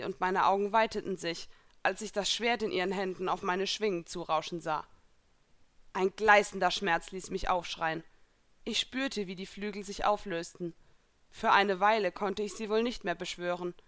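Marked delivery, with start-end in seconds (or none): none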